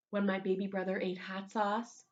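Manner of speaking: ordinary delivery, nothing marked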